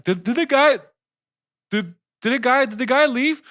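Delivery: high, loud, stammering mock worker; confused worker. Worker wondering if customer left, high confused voice. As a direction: stammer it high, as a puzzled question.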